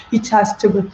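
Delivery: usual speaking voice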